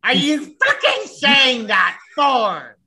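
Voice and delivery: high-pitched screeching mock tirade; angry character. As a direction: screech it out slowly in hammed-up outrage. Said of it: "that's an angry character that you're playing".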